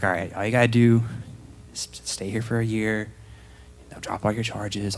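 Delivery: inner monologue. Retold or imitated. New arrival privately plotting a game plan, soft inner monologue. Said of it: "in my head, I'm".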